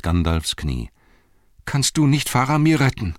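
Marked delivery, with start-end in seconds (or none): 1.6-3.1 high, urgent pleading; desperate plea. Acted. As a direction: beg for help, pitched well up. Pippin begs Gandalf for help.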